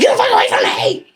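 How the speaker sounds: very high-pitched furious yell; re-enacted frantic yelling; retold yell. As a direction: scream it in a strained high voice. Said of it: "Can't believe you yelled at me like that".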